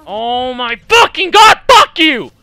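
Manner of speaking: loud outburst climbing into a shout; real gamer rage, yelled; raging. Real, not acted. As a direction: blow up at the game. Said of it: "I am raging way too fucking hard".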